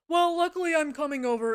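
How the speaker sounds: mocking impression of a boss; imitated boss. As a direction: mimic the boss announcing himself loudly.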